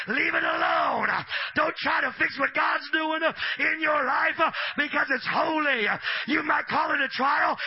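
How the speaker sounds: loud shouted sermon; preaching at full force. Acted. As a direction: preach it hard to the crowd.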